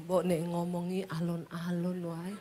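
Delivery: low, soft Solo-style reply; mild low soft Javanese husband. Retold or imitated. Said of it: "Orang Solo kalau ngomong nadanya turun terus".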